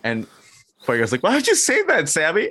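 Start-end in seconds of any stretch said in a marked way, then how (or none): 1.2-2.5 mock crying. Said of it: "he just starts crying".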